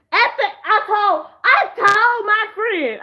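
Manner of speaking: high and laughing. Real, not acted. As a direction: giggle through it. Laughing while telling story.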